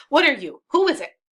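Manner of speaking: high, loud questioning; acted, rising-pitched challenge; demanding to know. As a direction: call down sharply to strangers below. Brabantio demands who is below.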